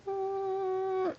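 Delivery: high thin mosquito hum; mosquito buzz. Retold or imitated. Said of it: "I hear the".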